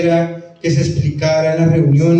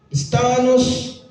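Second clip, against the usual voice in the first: estábanos drawn out slowly and pitched higher; slow, raised imitation of the rural estábanos; rural Tolima accent quote; 6 semitones higher, slower than usual. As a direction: mimic the village kids, stretching estábanos up high. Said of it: "Ellos dicen".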